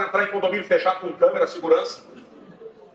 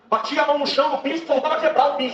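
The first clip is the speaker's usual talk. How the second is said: high, loud fervent preaching; preaching at full force; 9 semitones higher, 6 dB louder than usual. Acted. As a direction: build the testimony to a roar. (pregação em alta voz, fervorosa)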